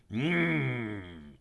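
long greedy "mmm"; savouring hum. A narrator's reading. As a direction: hum hungrily at a treat. Snake savours the sight.

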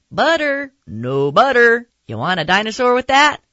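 loud, high popcorn seller's holler; shouting vendor. Acted. Popcorn man at the theater, shouting out to customers. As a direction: holler it out like a vendor over a crowd. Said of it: "the popcorn man shouting".

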